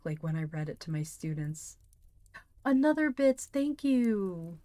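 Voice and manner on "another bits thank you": high, loud gushing thanks; excited thanks. Real, not acted. Streamer thanking a viewer for bits, high and loud. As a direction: gush your thanks.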